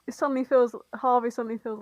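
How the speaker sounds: ordinary talk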